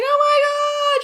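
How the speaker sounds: long, very high squeal; mimicking mom's loud, excited scream; imitated wild excitement. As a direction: screech it out slowly, overjoyed. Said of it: "She was so excited".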